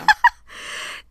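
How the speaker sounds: high, airy laugh; real giggling fit; burst of laughter. Real, not acted. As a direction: break up at the shared slip. Laughing at the shared mistake.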